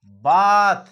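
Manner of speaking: बाथ said slowly and loudly as baath, long aa; clear word demo. Shown as a demonstration. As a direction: stretch the aa in बाथ. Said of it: "एक आवाज बता रहा हूं".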